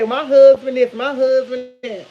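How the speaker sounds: loud mock bragging, then drawn out; mocking the classmate. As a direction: parrot her bragging, mockingly. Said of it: "that's what she always say".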